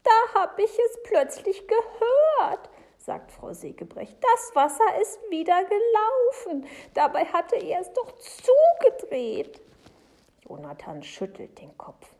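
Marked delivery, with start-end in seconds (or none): 0.0-2.7 very high, slow and loud; distraught Frau Segebrecht. Acted. Distressed woman recounts a scare. 4.2-9.6 high, loud, bewildered wail; distraught Frau Segebrecht. Acted.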